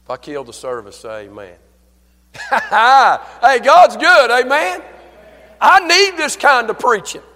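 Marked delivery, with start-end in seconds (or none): 2.3-3.3 big, high burst of laughter; exultant high laugh; loud laugh. Real, not acted. Bursts out before shouted preaching. 3.4-7.2 high, very loud preacher shout; exultant pulpit shouting; full-force preaching. Real, not acted.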